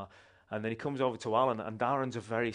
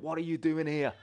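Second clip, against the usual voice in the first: raised, higher-pitched mock shout; retold shout; 6 semitones higher, 3 dB louder than usual. Bar owner confronting street preachers, retold shout. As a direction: imitate an angry man's shouted challenge. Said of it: "while he was shouting".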